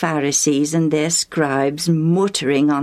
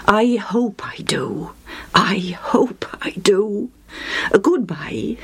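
slow whisper of hope; the man from Sidon hoping to meet Jesus, whispered; 4 semitones higher than usual. Acted. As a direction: breathe it, hushed and longing. Sidon man's hushed farewell.

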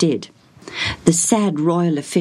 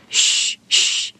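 unvoiced "shh, shh"; hushing. A narrator's reading. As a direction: hush the visitor for a sick child. Servant hushing the caller.

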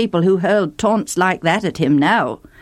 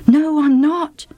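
nervous denial; 8 semitones higher, slower than usual. A narrator's reading. Fugitive denies at ford.

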